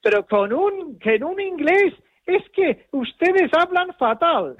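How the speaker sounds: high, mocking impression of the friend, slowing at the end; mock indignant friend. [name] imita a un amigo que critica el inglés de los españoles, voz aguda burlona. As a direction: whine it like a complaining foreigner mocking Spanish speakers. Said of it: "alguien me dijo".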